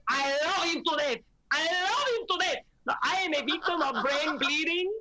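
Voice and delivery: Venezuelan accent impression. Mother imitating a Venezuelan rally woman's accent. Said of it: "obsessed with her accent".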